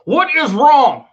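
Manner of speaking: real exasperation, slow and loud; indignant demand. Real, not acted. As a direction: lean hard into each word. Indignant rhetorical question.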